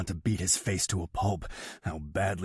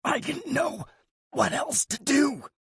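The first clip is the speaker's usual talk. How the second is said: much higher, strained brother's voice; croaking, shaking. A narrator's reading. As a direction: choke out the excuse. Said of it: "he croaks, voice shaking".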